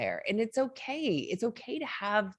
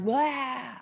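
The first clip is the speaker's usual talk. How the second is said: raised, mock devil blurt; Tasmanian devil; 6 semitones higher than usual. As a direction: toss it out like a cartoon devil. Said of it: "kinda like a Tasmanian devil".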